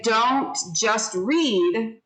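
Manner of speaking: each word drawn out slowly; slow and emphatic; slow, deliberate. Shown as a demonstration. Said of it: "So we would say".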